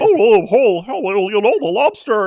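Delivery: high, loud send-up of a silly accent imitating someone; Jordan Peterson. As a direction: burst in with a ridiculous, high mimicking voice. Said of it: "starts imitating Jordan Peterson".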